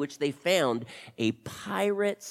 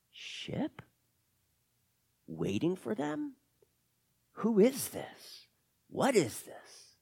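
soft, higher-pitched bewildered questions; puzzled wondering; 6 semitones higher, 5 dB quieter, slower than usual. Acted. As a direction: wonder aloud in hushed confusion. Lizzie puzzles over what was overheard.

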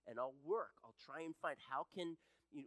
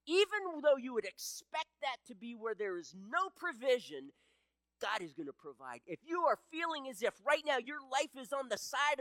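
louder, higher, driving each phrase to the congregation; full-force preaching; 7 semitones higher, 11 dB louder than usual. Acted. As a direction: preach it out with rising fire.

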